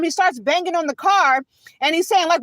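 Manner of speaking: ordinary delivery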